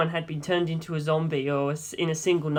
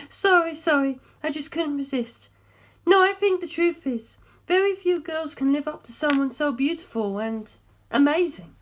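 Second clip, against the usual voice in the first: put-on young woman, higher than the narration, steady and serious; Darlington's voice; 10 semitones higher than usual. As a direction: lay out the findings calmly, like a briefing. Darlington apologizes after laughing.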